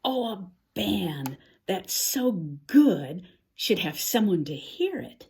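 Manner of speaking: plain reading voice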